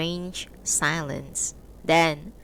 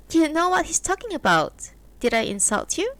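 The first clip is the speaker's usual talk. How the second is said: a hint of a higher woman's voice, teasing; lightly voiced wife; 10 semitones higher, 4 dB louder than usual. A narrator's reading. Wife teasing her husband about his new friend, lightly voiced. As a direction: voice the wife a little higher, mildly mocking.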